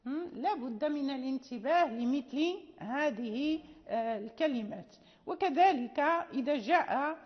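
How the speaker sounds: ordinary talk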